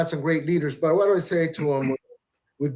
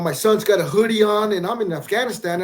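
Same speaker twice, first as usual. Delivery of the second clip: ordinary talk, nothing marked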